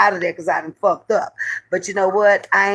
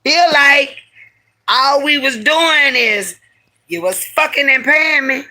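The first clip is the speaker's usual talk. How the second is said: angry demand; 7 semitones higher than usual. Retold or imitated. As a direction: demand payment hot. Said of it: "I say".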